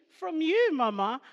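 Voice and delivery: high, loud voicing of a son; imitated son. Son crediting his mother, imitated in a high, loud voice. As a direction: pitch it up as the child answering. Voicing her son's reply.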